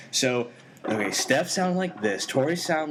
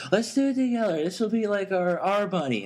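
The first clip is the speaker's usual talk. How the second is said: ex impression; 6 semitones higher than usual. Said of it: "She's like".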